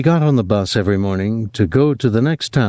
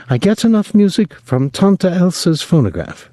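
Clotilde's voice; 10 semitones higher than usual. Acted.

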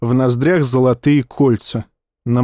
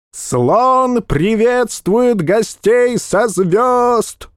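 town crier; 9 semitones higher, much slower than usual. Acted. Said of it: "орал тщедушный, тощий как глист, глашатай".

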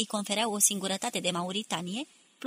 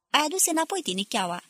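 high, lightly raised timid voice; a mild, timid cry, pitched up; 7 semitones higher than usual. A narrator's reading. Sembrano greets the returning pilot, crying out timidly. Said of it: "țipă Sembrano cu glasul lui timid".

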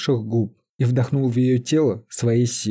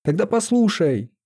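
yelling; 8 semitones higher than usual. A narrator's reading. Companion to hysterical Лиза, restrained yell. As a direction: raise pitch as if yelling. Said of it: "проорал спутник".

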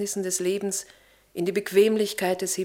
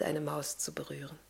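soft whisper; 4 semitones lower, 12 dB quieter than usual. Acted. Whispered end of mouse tale.

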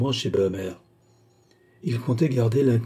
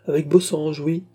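innocent reply; 6 semitones higher than usual. Acted. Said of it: "répondit innocemment".

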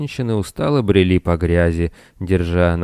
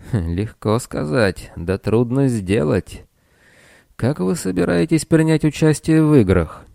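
doubtful; 7 semitones higher than usual. A narrator's reading. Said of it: "в голосе прозвучало сомнение".

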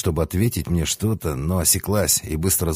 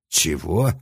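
sincere surprise. A narrator's reading. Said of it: "искренне удивился я".

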